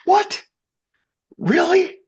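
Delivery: high, loud, disbelieving "What? Really?"; astonished. Real, not acted. Guest telling the host his shock at the news, astonished. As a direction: shoot up in amazement.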